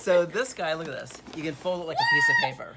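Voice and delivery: loud, fast excited showing off; eager salesman reveal. Real, not acted. Excitedly shows folding suitcase.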